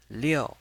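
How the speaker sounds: liu high, loud, falling; fourth tone. Shown as a demonstration. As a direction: drop "liu" firmly from a high start. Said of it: "pronounce it with a fourth".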